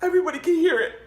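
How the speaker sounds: high loud laughing disbelief; horrified laugh. Real, not acted. Telling listeners about the noise, high laughing horror. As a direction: squeal it out in embarrassed glee.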